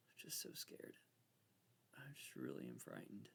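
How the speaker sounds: a soft, voiceless mock-scared whisper. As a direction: whisper it as if afraid to jinx the game. Hushed fear during the game.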